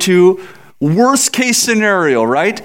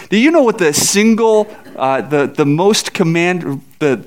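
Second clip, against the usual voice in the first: usual speaking voice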